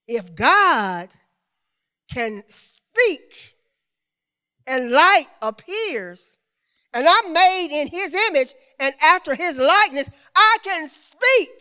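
loud, rising preacher's build; preaching. Acted. Preacher tells congregation their word brings light, preaching at full force. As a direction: climb to a high, stretched peak.

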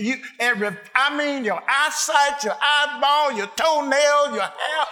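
loud, rising sermon cadence; preaching at full force. Acted. Pastor preaching to his congregation, full force. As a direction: preach it out to the church, building.

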